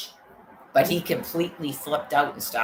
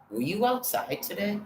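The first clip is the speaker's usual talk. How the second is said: a prying housemate, pitched higher; 8 semitones higher than usual. Retold or imitated. Roommate quizzing the man who came home, suspicious. Imagining housemate questioning attacker.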